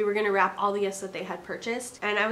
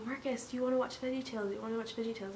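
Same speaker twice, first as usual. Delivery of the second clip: put-on hushed, lighter coaxing to a toddler; soft gentle voice to calm a crying child; 7 dB quieter, much faster than usual. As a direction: whisper the offer to distract him. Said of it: "kept saying".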